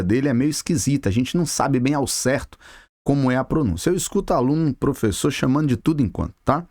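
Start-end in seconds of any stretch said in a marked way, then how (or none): none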